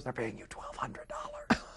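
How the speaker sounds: slow, drawn-out whisper of disbelief; awestruck whisper. Retold or imitated. Dad revealing the fee to his son, slow awestruck whisper. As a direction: whisper the huge figure slowly, as the dad.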